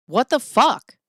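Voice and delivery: loud, high shocked "what the fuck"; shocked outburst. Retold or imitated. Telling her family, stunned and loud. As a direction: blurt it out in disbelief, loud and high.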